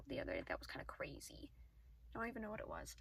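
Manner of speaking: breathy whispered aside. Real, not acted. Recalling something odd, she whispers to herself. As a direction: drop to a whisper, thinking aloud.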